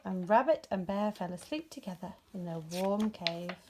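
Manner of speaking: plain reading voice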